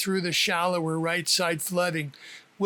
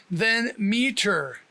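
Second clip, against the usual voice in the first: "meter" said slowly and clearly; slow syllable demo; slower than usual. Shown as a demonstration. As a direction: teach the last piece slowly. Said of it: "He emphasizes the pronunciation".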